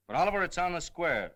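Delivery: loud insisting. Acted. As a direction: push it hard to convince him. Insisting to the editor.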